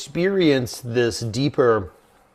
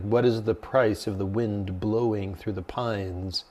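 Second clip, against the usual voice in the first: low, soft, unhurried monk; slow, hushed recital of a riddle; monk posing a koan; 3 semitones lower, 6 dB quieter than usual. Retold or imitated. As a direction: pose a riddle quietly and slowly. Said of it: "he offered a koan. He's like".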